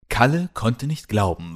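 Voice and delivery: ordinary delivery, nothing marked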